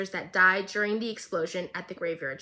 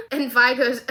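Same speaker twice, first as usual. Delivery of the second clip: ordinary talk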